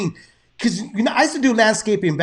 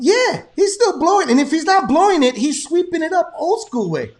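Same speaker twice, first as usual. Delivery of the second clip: higher and louder, amused and excited; amused excitement; 7 semitones higher, 5 dB louder than usual. Real, not acted. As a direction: marvel at the guy, voice up. Laughing about worker in lightning.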